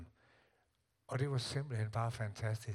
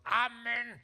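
put-on amen yelled high and loud, a child's voice; louder shouted amen; 12 semitones higher, 4 dB louder than usual. Child in bed yelling amen to mother downstairs, high and loud. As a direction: call it so mum can't miss it. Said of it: "så råbte jeg".

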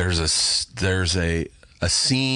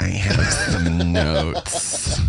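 very slow, stretched-out mock executive; annoying network executive; much slower than usual. Network woman to the writers, maddeningly drawn-out. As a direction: draw every word out to maddening length. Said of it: "as annoying as possible because of her job".

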